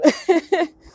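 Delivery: short loud laugh; high laugh. Real, not acted. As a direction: burst out laughing. Laughing after singing.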